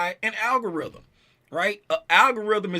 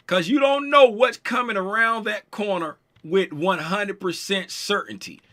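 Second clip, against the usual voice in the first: normal talking voice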